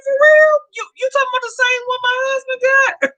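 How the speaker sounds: mock amazement. To ex bragging about his truck, high sarcastic amazement. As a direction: feign wide-eyed surprise, teasing. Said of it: "I said".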